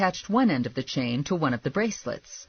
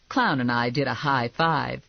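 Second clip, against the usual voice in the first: usual speaking voice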